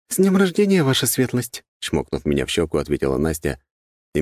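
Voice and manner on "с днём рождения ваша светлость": lively, high-pitched sister; sister's birthday greeting. A narrator's reading. Sister congratulates her brother on his birthday, high and lively. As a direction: greet him brightly in a higher voice.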